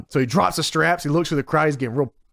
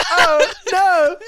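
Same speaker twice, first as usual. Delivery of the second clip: high, laughing mock despair; a hammed-up wail through laughter; Sharmell's dismay; 13 semitones higher, 4 dB louder than usual. Playing Sharmell as the door closes, laughing wail. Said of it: "And she goes".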